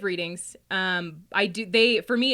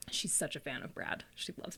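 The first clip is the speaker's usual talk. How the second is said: whispered, softer; whispered aside; 4 semitones lower, 11 dB quieter than usual. Real, not acted. Gossiping about the psychic, whispered. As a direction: lean in and whisper it.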